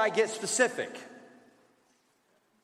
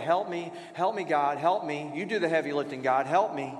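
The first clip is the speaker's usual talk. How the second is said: mock vague prayer; 6 semitones lower than usual. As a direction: rattle off a lazy help me prayer. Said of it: "keep it the help me phrase".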